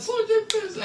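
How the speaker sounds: very high squealed reply; mock panic. Drunk son answering his father, squealed mock panic. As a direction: squeal the confession in comic panic.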